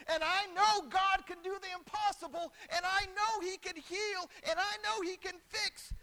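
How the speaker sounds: high, loud insistent declarations; full-force preaching. Real, not acted. Preacher voices believer sure of God's power, insistent. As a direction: build each claim at pulpit volume.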